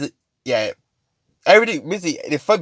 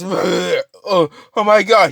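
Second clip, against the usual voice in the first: horrified; 7 semitones higher, much slower than usual. Acted. Mineta sickened by the body, horrified. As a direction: gasp it out in acted horror.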